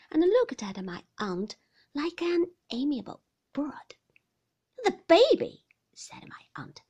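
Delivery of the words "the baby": loud, high, sharp correction; impatient insistence. A narrator's reading. Aunt correcting the visitor about the baby, impatient. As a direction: spell out who is meant, high and loud.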